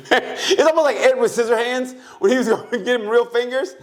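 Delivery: high-pitched telling through laughter; real laughing. Real, not acted. Comparing the shooting to Edward Scissorhands, laughing. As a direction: keep talking while tickled.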